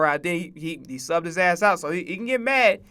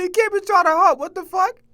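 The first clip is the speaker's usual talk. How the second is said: high mock coach yell, pitched way up; imitating the coach; 11 semitones higher, 4 dB louder than usual. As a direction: squeal it out, mocking the coach. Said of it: "He said".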